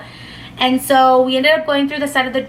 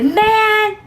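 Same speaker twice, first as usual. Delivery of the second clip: a high, drawn-out whine of a rejected guy; whiny man; 9 semitones higher than usual. Retold or imitated. Rejected guy whining at the girl who turned him down, pitched high. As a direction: whine the word up high like a sulky kid.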